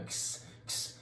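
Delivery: X breathed out as a voiceless ks; the opening ks sound of Xinomavro, whispered. Shown as a demonstration. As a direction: hiss the X as a voiceless ks, no voice. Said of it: "kinda like a K S at the same time".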